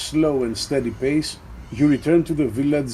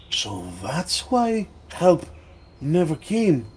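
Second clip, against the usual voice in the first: lightly voiced innkeeper, pitched higher; a touch of dismayed realisation, lifting in pitch; Matos; 5 semitones higher than usual. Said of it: "Matos comes out, looks at you".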